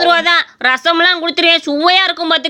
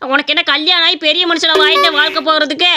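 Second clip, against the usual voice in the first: normal talking voice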